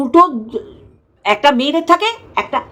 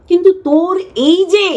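normal talking voice

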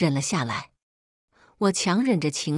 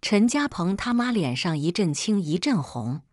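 ordinary delivery, nothing marked

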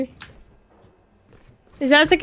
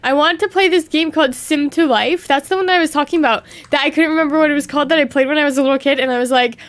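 usual speaking voice

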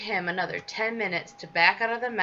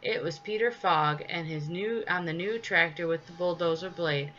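plain reading voice

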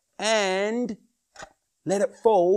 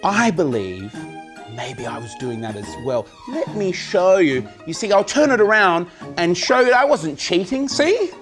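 loud, high showman patter; kids party magician; 4 semitones higher, 7 dB louder than usual. Acted. Magic show for children.

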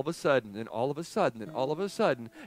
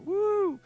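high, loud "woo!"; quick burst of a delighted whoop; whooping over a treat; excited whoop; 7 dB louder than usual. Acted. Celebrating the popcorn treat.

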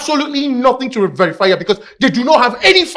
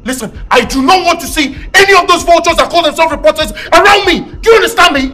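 angry outburst; 3 semitones higher, 4 dB louder than usual. Acted. Son loses patience with his teasing brother, loudly angry. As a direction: snap at him to stop, fed up.

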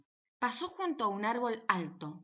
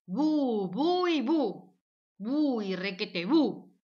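escalating boo; 6 dB louder, much slower than usual. Acted.

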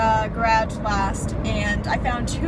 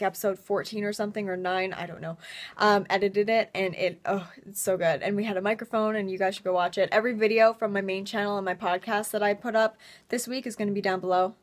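usual speaking voice

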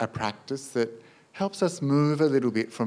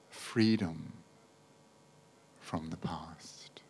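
guided meditation; 3 semitones lower, 5 dB quieter than usual. Acted. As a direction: gently guide them into letting go, unhurried.

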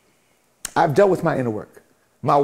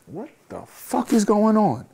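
slow, higher, stunned question; acted bewilderment; 6 semitones higher, slower than usual. Speaker imagines waking up after burnout, bewildered. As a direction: stretch it out in baffled disbelief.

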